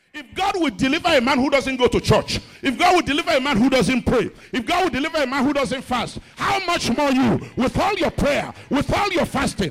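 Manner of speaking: loud, high, building preacher's cry; preaching at full force. Acted. Pastor preaching to the congregation at full force. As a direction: hammer each line to the church.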